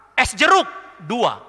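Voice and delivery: "jeruk" with a clear R, said high and loud; loud proud order. Retold or imitated. Boyfriend repeating his order to the waiter, loud and proud. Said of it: "Dia hafal".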